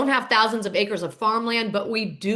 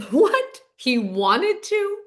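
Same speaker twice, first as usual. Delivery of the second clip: incredulous; 6 semitones higher, much slower than usual. Real, not acted. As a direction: question it in scoffing disbelief. Mocking the mother's claim.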